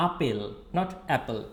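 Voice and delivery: আপেল said as a short pel, then English apple; Bangla apple. Shown as a demonstration. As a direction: contrast আপেল against apple. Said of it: "we say this in a little bit distorted way".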